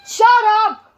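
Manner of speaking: high, loud snap; angry shout. Real, not acted. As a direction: snap at them loudly. Yelling at others off camera.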